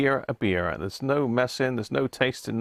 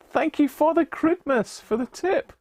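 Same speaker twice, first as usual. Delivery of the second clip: much higher, playful squeaky voice of thanks. Pretend. Thanking a viewer for the tip in a squeaky high voice. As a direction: chirp the thanks up high like a cartoon.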